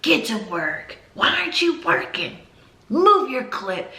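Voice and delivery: high, sharp scolding demands; nagging strict teacher. Acted. Teacher ordering a child who won't work, mock scolding. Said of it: "don't wanna be that teacher that's like".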